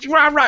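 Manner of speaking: high, loud cartoon growl; mock roar. Host imitates someone yelling, mock growling. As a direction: bark it like a toy monster. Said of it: "and you just go".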